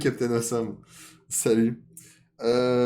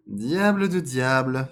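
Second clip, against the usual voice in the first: slow, loud, raised oath; fox exclaiming; 5 semitones higher, 8 dB louder, much slower than usual. Acted. Fox dreading the fleas, slow loud exclamation. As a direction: draw the curse out loudly. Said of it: "fit le renard".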